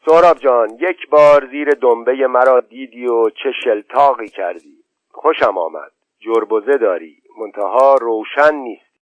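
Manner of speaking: ordinary delivery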